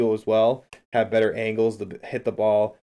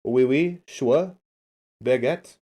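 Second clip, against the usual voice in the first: mock French accent; 3 semitones higher, 3 dB louder, much slower than usual. Shown as a demonstration. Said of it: "Does that sound French?".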